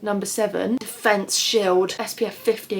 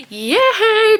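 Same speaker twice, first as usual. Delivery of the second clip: high, loud cheer; excited cheer; 12 semitones higher, 9 dB louder than usual. Real, not acted. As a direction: cheer like you're finally off. Heading out the door.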